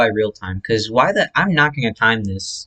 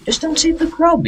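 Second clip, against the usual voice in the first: much higher, emphatic squeaky plea; exaggerated high plea. Shown as a demonstration. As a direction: squeak it out, begging them. Repeated advice, pitched way up.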